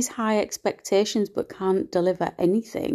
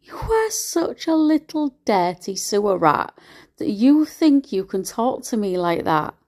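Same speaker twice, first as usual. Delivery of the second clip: ordinary talk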